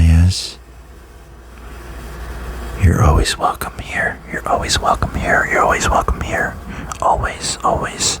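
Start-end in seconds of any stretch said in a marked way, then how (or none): 2.8-8.2 soft ASMR whisper; tender whispered welcome, repeated; whispered pep talk. Real, not acted.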